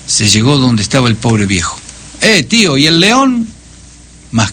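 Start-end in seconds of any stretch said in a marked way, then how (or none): 2.2-3.5 high, cheeky jeering question; mocking taunt. A narrator's reading. Boy Paco mocks the grieving blind man, high and jeering. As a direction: taunt the old man with a nasty grin. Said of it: "su vocecita chillona y su aire descarado".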